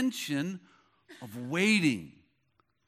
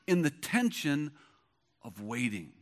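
softer, lower, trailing into near silence; dropping to a hush; 5 semitones lower, 5 dB quieter than usual. Shown as a demonstration. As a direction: let it fade to a hush on waiting. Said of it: "Let me say that again".

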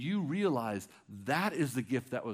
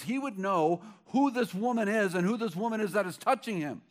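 loud, raised, urgent retelling; impassioned storytelling; 7 semitones higher, 6 dB louder than usual. Acted. Pastor retelling the sinful woman at dinner, impassioned preaching. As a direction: paint the scene for the whole room with fire.

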